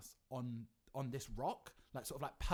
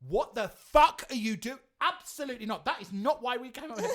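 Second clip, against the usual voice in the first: much louder and higher, shocked and indignant; retold outraged yell; 10 semitones higher, 14 dB louder than usual. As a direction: blast it out like you've just been flashed. Outraged at the wanking waiter.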